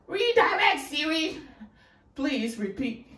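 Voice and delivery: mock command, laughing. Mocking her own Siri struggle.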